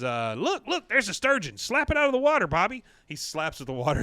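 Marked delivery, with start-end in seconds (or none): 0.3-2.8 high, urgent mock shouting; frantic mother voice, pitched way up; excited Peggy. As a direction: urge the kid on in a rush of excitement. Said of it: "she says".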